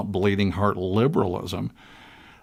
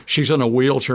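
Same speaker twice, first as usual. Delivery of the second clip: usual speaking voice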